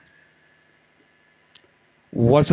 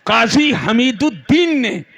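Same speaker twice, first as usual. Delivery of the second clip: refrain rising, each name answering it; rhythmic preaching; 6 semitones higher than usual. Real, not acted. Preacher lists saints who spread Islam, rhythmic rising refrain. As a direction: ring out a roll call with suspenseful pauses.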